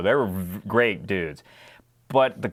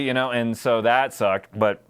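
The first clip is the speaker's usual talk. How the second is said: usual speaking voice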